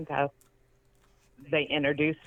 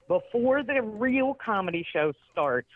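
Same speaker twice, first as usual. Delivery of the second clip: high, slow mock announcement; charity host announcing; 6 semitones higher, slower than usual. Man in charge of the charity to the audience, slow mock announcing. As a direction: imitate an organiser addressing the room, pitched up.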